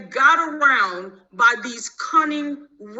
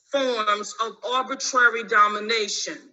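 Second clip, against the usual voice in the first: normal talking voice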